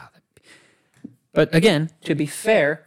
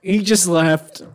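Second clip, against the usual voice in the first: slow words through laughter; laughing; 3 semitones higher, 4 dB louder, slower than usual. Real, not acted. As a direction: laugh it out slowly. Laughing while telling.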